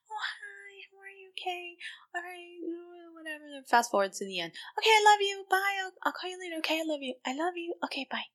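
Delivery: high, soft, sing-song mimicry of a couple; mocking phone lovebirds. Friend mocks couples cooing on the phone, high and soft. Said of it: "hear people have this conversation on the phone".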